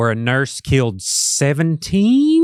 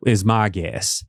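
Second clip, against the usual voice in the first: normal talking voice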